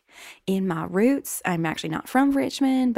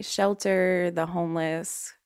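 usual speaking voice

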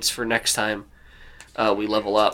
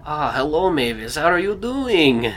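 put-on higher, drawn-out Russian accent; Roman's Russian accent; 4 semitones higher than usual. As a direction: greet in a slow, lilting Russian accent. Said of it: "I mean, a Russian accent".

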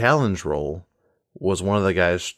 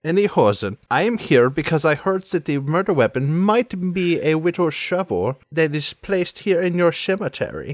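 put-on theatrical accented villainess, a little higher; Sans Serif, businesslike; 8 semitones higher than usual. Telling him about the murder weapon, accented villainess. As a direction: get to business with slushy s sounds.

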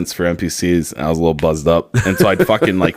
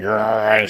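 drawn-out "grr" like a dog; playful mock dog snarl; imitated dog growl; 3 semitones higher than usual. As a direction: growl like a dog going for another. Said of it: "she's doing, like, her, like".

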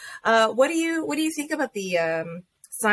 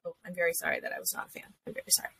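mock apology; 11 dB quieter, much faster than usual. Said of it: "I was like".

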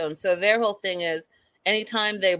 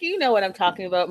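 quick mock insistence; faster than usual. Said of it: "I'm like".

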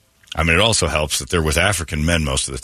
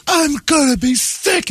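much higher, slow mock of a nauseous woman; theater lady beside him at City Slickers, high mock queasy voice; slower than usual. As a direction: imitate a woman about to throw up. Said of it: "The lady next to me goes".

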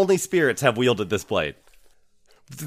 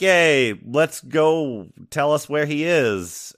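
higher, louder mock cheer; retold cheering; 6 semitones higher, 4 dB louder, much slower than usual. Party eager to go find him, retold cheer. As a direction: act out an eager group hurrah.

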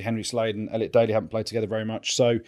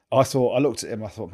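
ordinary delivery

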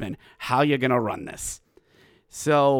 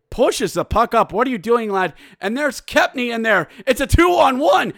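high, loud goal call, shouting; excited play-by-play; 12 semitones higher, 7 dB louder than usual. Acted. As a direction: call the two-on-one live, erupting at the goal.

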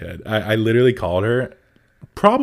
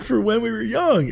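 high-pitched, incredulous retold reply; imitated exasperated excitement; 13 semitones higher than usual. Retelling telling a clueless friend about the festival, high exasperated excitement. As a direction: pitch it way up, half laughing in disbelief.

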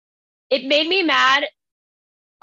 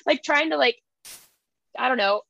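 ordinary talk

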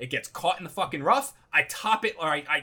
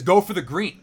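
brisk, louder order at normal pitch; caddy's instruction; 5 dB louder than usual. Retold or imitated. Caddy handing over a seven iron, confidently instructing. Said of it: "hands me my seven iron and says".

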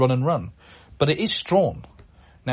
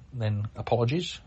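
usual speaking voice

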